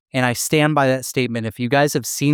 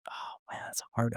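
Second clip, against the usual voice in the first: whispered, torn: oh man; whispered dilemma; 16 dB quieter than usual. Real, not acted. Cohost, torn between two favorite movies, whispers. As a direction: breathe it out, stuck choosing.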